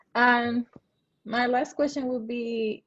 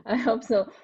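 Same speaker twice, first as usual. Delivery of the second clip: laughing. Real, not acted. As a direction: say it through a real laugh. Host laughs along, closing.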